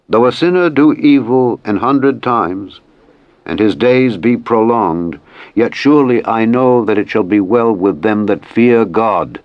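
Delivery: ordinary delivery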